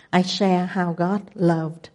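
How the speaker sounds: ordinary talk, nothing marked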